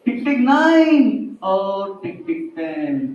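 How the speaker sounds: slow, drawn-out countdown to the final count. Acted. Teacher slowly counting down to ten for the children, drawn out. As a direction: stretch the last counts out slowly, teasing.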